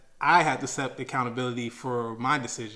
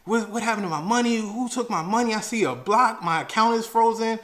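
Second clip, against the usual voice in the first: higher, fast, upset complaint; yelling at the bank; 8 semitones higher, 4 dB louder than usual. Retold or imitated. Yelling at the bank about missing money, higher and fast. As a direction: fire questions at them in a panic. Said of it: "yelling at the bank".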